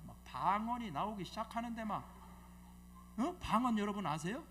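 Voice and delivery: normal talking voice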